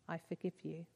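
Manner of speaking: hesitant, held-back forgiveness; half-hearted whisper. A narrator's reading. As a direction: forgive him with no conviction yet, barely voiced. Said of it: "whisper in tones half-hearted and stumbling".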